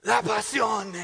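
put-on slow, loud, high foreign-accented cry; triumphant proclamation. Husband's final punchline to piano-teacher wife, loudly proclaimed. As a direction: proclaim it as the grand mock climax. Final climax of building mock speech.

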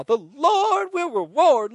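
revival shout. Shown as a demonstration. Pastor tells viewers to shout it to family, loud. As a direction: proclaim it to your brother. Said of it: "And say it to him now".

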